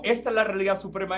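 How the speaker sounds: high mocking insistence; mock claimant. A hint of mocking voices claiming supremacy. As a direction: parody each tradition's claim. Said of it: "como me parecía eso".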